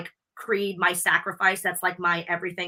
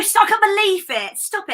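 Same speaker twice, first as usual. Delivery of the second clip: high, loud, flustered disbelief; playful protest; 11 semitones higher, 7 dB louder than usual. Real, not acted. Guest brushing off interviewer's compliment on her accent, playful loud protest. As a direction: pitch jumps up as you wave off the praise.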